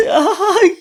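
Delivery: a high laughing mock "¡Ay!" of fright; mock fright cry. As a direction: yelp like a scared disciple, half laughing. Mimics the frightened disciples. (preacher acting out the disciples' fear, mock frightened "¡Ay!")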